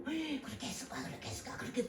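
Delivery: soft, half-whispered brooding; mock muttering. Preacher mimicking a brooding believer, softly muttering. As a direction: mutter it like a worried doubter.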